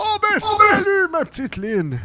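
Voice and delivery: swooping, doting hello to a favorite; delighted Santa Claus. Acted. Santa greets Lynn.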